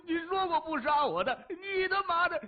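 doomed captive cursing the boss; cursing furiously. A narrator's reading. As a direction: rage at the betrayer. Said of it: "大骂着".

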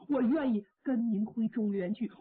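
plain reading voice

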